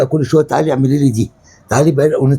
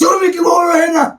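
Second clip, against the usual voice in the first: high-pitched outraged shout; angry yelling. Acted. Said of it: "عيب ما تزعقش". (زعيق غاضب بطبقة عالية)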